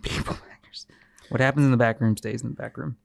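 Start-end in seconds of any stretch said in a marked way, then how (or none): 0.0-0.9 hushed, voiceless whisper; whispered echo. Pretend. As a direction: whisper it under your breath.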